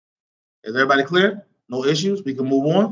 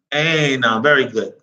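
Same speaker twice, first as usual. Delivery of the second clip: ordinary delivery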